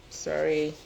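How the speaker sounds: usual speaking voice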